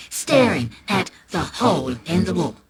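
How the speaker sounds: ordinary delivery, nothing marked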